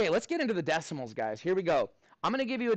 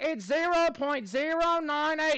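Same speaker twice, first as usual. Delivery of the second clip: high, slow mock schoolkid in an Australian accent; pupil answering, Aussie accent; 10 semitones higher, slower than usual. Pupil answering a scary teacher, high put-on Aussie voice. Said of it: "And you're like".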